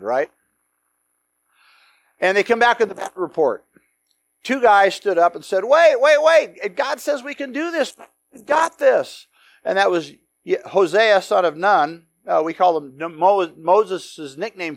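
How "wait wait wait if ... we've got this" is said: urgent plea. Acted. Two spies urging the crowd.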